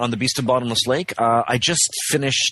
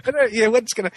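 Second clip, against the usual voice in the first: much higher, lightly excited asking; eager retold question; faster than usual. Actor retelling his question to the producer, higher and eager.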